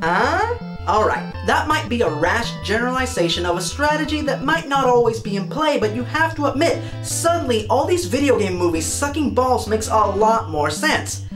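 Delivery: usual speaking voice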